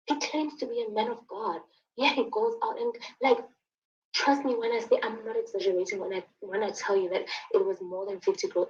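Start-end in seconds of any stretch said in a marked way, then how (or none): none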